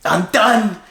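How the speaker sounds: high-pitched mock outburst, each phrase punched out; acting out a fed-up yell; retold angry scream. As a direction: blow up at the boss, high and exasperated. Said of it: "he screams at the producer".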